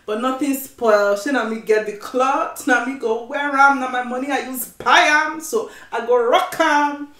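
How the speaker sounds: playful Nigerian Pidgin boast. Pretend. Playful Pidgin boast about outfit.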